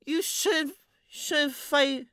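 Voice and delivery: put-on high, very slow drunken slur; slurred voice. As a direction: slur it like a tipsy girl. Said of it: "speaking like a slurred voice".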